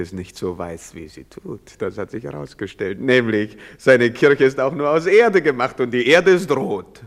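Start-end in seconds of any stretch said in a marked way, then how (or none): 3.0-7.0 louder, ranting declaration; a sudden loud rant; heated outburst. Acted. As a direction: blurt it out loud and defiant. Drunk man's tirade about church.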